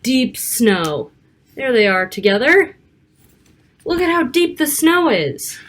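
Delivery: lively picture-book read-aloud; reading to children. Acted. Narrator reading a snowy storybook to young children, lively. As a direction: read it like storytime for little kids.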